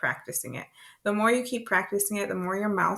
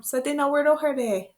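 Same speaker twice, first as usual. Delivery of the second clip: "Sa de na weirdo herde" called out higher; instructing a child; 6 semitones higher, 3 dB louder than usual. Pretend. Parent sending a child into the bathroom, high lilting call.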